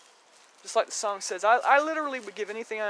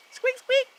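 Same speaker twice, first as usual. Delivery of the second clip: mock squeak; 8 dB louder than usual. Imitating rat squeaks.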